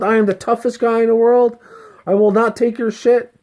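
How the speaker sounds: high-pitched, forceful boast; puffed-up ego. Acted. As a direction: play the ego squaring up for a fight. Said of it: "When your ego is talking like that".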